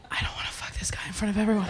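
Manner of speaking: hushed, hurried aside; panicked aside. Retold or imitated. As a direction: blurt it under your breath, fast. Panicked aside to herself at party.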